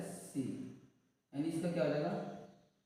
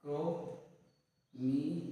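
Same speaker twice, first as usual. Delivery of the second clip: Chromium drawn out like dictation; drawn-out teacher voice; much slower than usual. Shown as a demonstration. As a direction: stretch chromium very slowly with a rising end. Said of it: "क्या हो जाएगा?".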